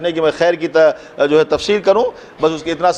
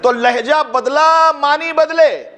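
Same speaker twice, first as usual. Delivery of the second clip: impassioned preaching climax; 11 semitones higher than usual. Real, not acted. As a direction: build to a rousing, chanted pitch for the congregation. Preacher builds to rousing climax.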